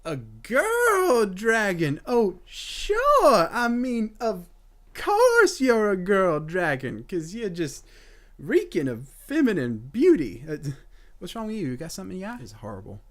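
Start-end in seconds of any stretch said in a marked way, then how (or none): 0.1-10.4 loud, higher mocking taunt; sarcastic mockery. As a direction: sneer at it with fake awe. Mocking the dragon, sarcastic rant.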